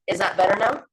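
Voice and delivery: choppy, glitchy robotic audio. Real, not acted. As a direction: speak through a garbled robot-voice connection. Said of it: "kind of like a robot voice going on".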